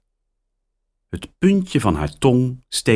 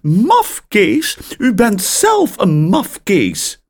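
much higher, louder indignant retort; angry caller; 14 semitones higher, 5 dB louder, slower than usual. A narrator's reading. Insulted caller to parrot, high and loud. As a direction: snap back at the insult.

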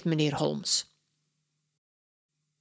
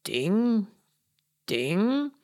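lightly voiced "Ting, ting" as a lobby bell; ping it out like a hotel desk bell; imitating a bell; 3 semitones higher than usual. Detective copies the parrot's bell sound, mild imitation. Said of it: "Meneer Holmes doet de geluiden na".

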